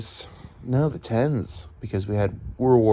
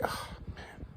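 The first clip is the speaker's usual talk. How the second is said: whisper; 14 dB quieter than usual. Retold or imitated. Frustrated aside about the dog.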